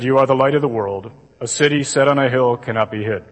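low, level drone with no expression; monotone gospel reading. Shown as a demonstration. Imitating a priest reading at Mass, monotone. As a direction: recite it like a bored lector. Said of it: "Ever heard it read like that?".

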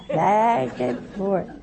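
very slow, stretched-out "back and forth"; drawn-out swinging. Shown as a demonstration. Preacher showing head swinging to and fro, drawn out. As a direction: draw out each word of "back and forth".

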